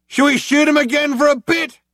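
loud, high, casual; cop shouting to partner. A narrator's reading. Cop shouting to his partner, loud and high. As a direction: shout the idea cheerfully. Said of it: "One cop shouted to the other".

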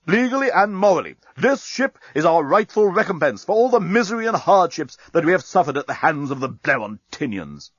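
high, declaiming indignation; indignant captain, defensive. A narrator's reading. Captain justifying taking the ship, pompous indignant declamation. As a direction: proclaim the rightful claim, emphatic.

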